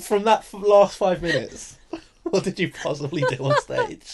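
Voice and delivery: high, loud, laughing through the question; cracking up while asking; laughing disbelief. Real, not acted. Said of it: "laughing disbelief".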